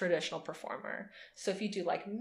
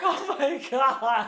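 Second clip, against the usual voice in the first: slow, laughing disbelief; drawn-out laughing oh my God; giddy laughing; 6 semitones higher, 6 dB louder, much slower than usual. Retold or imitated. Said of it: "And it's like".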